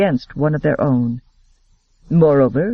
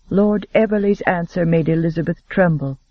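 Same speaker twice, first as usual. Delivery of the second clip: ordinary delivery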